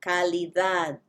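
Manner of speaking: "Calidad" with a soft th; "Calidad" mein naram th jaisi d. Shown as a demonstration. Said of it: "soft T H sound".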